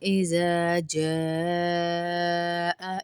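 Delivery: اذا slowly, with the alif stretched one count; اذا جاء drawn out on the long vowel; lengthened alif. Shown as a demonstration. Teacher demonstrates the long alif in اذا. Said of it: "اذا کو ایک الف کے برابر لمبا".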